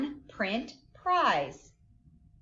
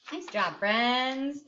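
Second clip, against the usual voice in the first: ordinary delivery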